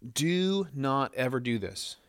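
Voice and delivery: slow, weighted "Do not ever do this"; emphatic. Retold or imitated. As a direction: lean on "Do", slow and deliberate. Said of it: "He is emphatically saying".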